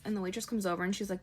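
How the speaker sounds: usual speaking voice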